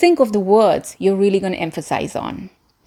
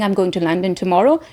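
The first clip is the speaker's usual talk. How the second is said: rushed, with the ending fading away; trailing off; faster than usual. Shown as a demonstration. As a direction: throw the sentence away and let tomorrow fade. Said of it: "Instead of saying".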